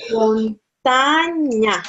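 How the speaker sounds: montaña drawn out slowly, stress on TA; slow model word. Shown as a demonstration. Teacher models montaña slowly for students. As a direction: stretch montaña syllable by syllable. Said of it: "if I say".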